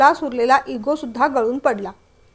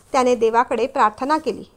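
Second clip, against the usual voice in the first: ordinary delivery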